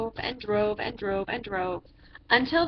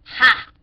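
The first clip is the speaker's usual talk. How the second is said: loud scornful ha; mocking ha; 8 dB louder than usual. A narrator's reading. Judge scoffing at Matthew's sand house. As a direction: bark a loud mocking laugh. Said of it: "said the judges".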